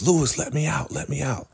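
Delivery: high-pitched mock pleading; acting out a trapped man's call; imitated plea. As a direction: beg to be let out, pitched well up. Locked-up man pleading for release.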